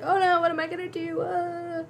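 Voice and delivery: high-pitched pretend wail; hammed-up helpless whimper, pitched well up; mock crying. Lost droid alone in the sand, mock crying. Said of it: "He's crying the whole time".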